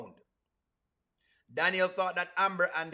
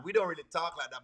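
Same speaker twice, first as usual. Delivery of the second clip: ordinary talk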